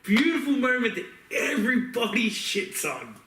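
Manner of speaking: ordinary delivery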